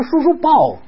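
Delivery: acted baby-talk coaxing; coaxing a toddler. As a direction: offer a hug in a high child-directed voice. Repeats his coaxing of the toddler.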